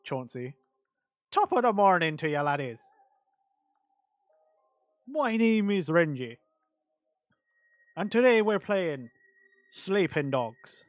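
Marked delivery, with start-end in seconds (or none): 1.3-10.8 hammed-up Irish accent, higher and louder; a cheesy Irish YouTuber intro; over-the-top stage Irish accent, slowing on the game name; bad Irish accent. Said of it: "My Irish accent is awful".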